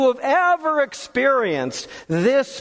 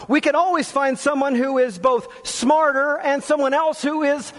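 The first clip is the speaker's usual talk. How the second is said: usual speaking voice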